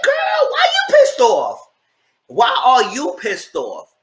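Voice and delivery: high slow shouted disbelief; a shrieking demand to know why; incredulous shout. Retold or imitated. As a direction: yell it out slow and stunned. Host yells at the catfish.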